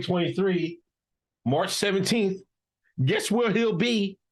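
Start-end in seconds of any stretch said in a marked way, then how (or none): none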